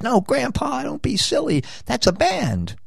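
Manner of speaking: put-on high, soft kid voice for the grandson. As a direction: play the grandson, high and gentle. Grandson voiced in retold story.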